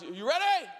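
dad shouts from the boat; calling from boat. Retold or imitated.

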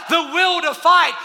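usual speaking voice

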